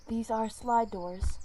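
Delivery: ordinary delivery, nothing marked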